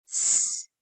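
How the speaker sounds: voiceless "S" held as a hiss; hissed s sound. Shown as a demonstration.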